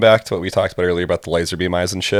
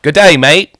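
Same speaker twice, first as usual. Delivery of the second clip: quick hammed-up Australian greeting, higher and louder; cheery put-on Australian accent; 7 semitones higher, 8 dB louder than usual. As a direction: toss off a cartoon Australian hello. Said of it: "Can you do an Australian accent, mate?".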